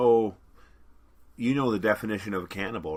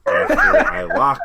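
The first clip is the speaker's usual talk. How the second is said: laughing; 12 dB louder than usual. Real, not acted. Joking break announcement, laughing.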